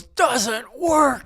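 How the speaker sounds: high-pitched abrupt yell in someone's face; imitated scream. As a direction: yell it high, cutting the other person off. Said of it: "He just screams at me".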